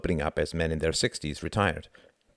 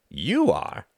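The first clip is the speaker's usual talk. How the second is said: raised, doubting question; skeptical; 7 semitones higher than usual. A narrator's reading. Reginald questions his friend's ambitious claim, skeptical. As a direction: query it with open doubt. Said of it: "asked Reginald skeptically".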